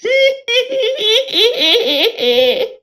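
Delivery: high-pitched squealing giggle; high giggly cry. Acted. Student bumps into someone, high giggly cry. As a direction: let out a long, high staccato titter.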